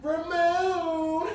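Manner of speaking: high, drawn-out flirty call; hammed-up slow, sultry admirer; mock sexy. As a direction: purr the name playfully, stretching it out. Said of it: "Ramon sounded sexy".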